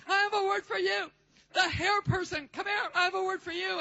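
loud, high-pitched summons; prophetess calling out. Retold or imitated. Prophetess picks the hair person from the meeting, calling out loudly. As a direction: call out a prophetic word with force. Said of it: "And she'd say".

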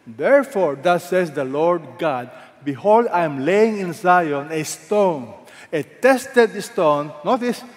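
proclaiming scripture. Acted. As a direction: declare the prophecy slowly. Reading Isaiah prophecy with weight.

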